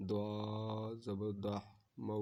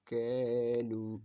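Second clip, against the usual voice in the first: ordinary talk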